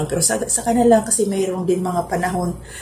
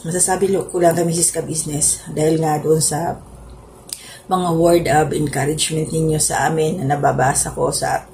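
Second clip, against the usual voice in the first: ordinary talk, nothing marked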